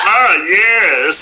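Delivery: high, proper little alien; prim Martian gentleman; Marvin the Martian voice. Retold or imitated. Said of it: "a voice like this".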